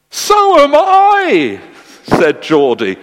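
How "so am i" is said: long, very high delighted shout; hammed-up squeal of joy, stretched out; ecstatic outburst. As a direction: cry it out loud and sky-high. Geordie's climactic delighted outburst.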